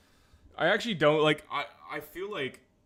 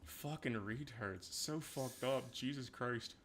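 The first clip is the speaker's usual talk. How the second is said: muttered; 7 semitones lower, 15 dB quieter, slower than usual. Real, not acted. Muttering over game clip.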